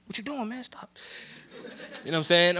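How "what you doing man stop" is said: hushed self-scolding. Retold or imitated. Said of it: "I'm like".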